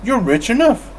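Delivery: high, pointed comeback; quick retort. Acted. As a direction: toss it back with a grin. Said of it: "Fred shot back".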